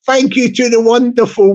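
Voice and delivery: normal talking voice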